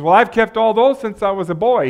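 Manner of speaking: high, loud, self-satisfied reply; pleased with himself. Acted. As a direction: boast about a spotless record. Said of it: "He was feeling pretty good".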